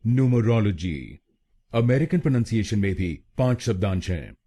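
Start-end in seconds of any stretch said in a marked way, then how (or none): 0.1-1.2 न्यूमरोलॉजी with American "noo" start; American pronunciation. Shown as a demonstration. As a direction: say न्यूमरोलॉजी the American way, noo-muh-ROL-uh-jee. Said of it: "American pronunciation में इसको बोलेंगे". (American उच्चारण में न्यूमरोलॉजी)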